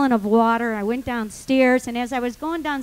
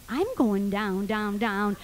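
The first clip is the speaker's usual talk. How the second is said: lower, slow echo of the song; resigned thought; 4 semitones lower, 3 dB quieter, slower than usual. Retold or imitated. Trapped woman applying the lyric to herself, resigned. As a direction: say it to yourself, sinking. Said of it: "I'm thinking to myself".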